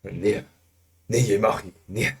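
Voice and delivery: lightly imitated Afrikaner, repeating nee; a hint of an Afrikaner refusing; mock Afrikaner no. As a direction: tease how Afrikaners keep saying nee. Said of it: "it's a thing just to say".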